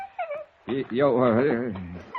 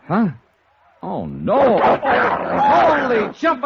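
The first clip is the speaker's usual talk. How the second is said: high, drawn-out startled cry; 8 semitones higher, much slower than usual. Acted. Johnny startled as the big dog jumps at him, high cry. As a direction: yelp it as the dog leaps up.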